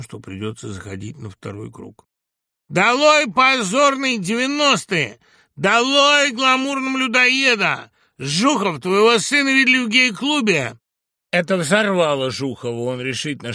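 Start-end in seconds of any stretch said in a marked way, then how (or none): 2.7-10.8 high, loud, drawn-out rally cries; shouted slogans. A narrator's reading. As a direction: chant denunciations at full voice. Hecklers chanting slogans at opponent. (выкрикивание лозунгов)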